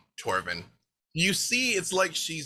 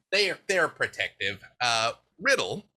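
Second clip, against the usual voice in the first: usual speaking voice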